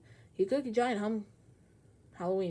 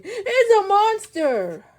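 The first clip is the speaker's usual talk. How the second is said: much higher, louder, slow shriek; terrified dragon; 12 semitones higher, 11 dB louder, much slower than usual. Acted. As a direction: cry out in terror. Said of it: "he screamed".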